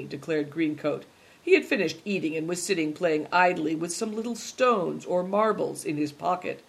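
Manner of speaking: plain reading voice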